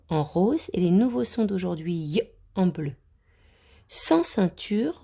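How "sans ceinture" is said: "Sans ceinture" read slowly and higher; slow emphatic reading. Shown as a demonstration. Teacher reading a phrase slowly and clearly to the children.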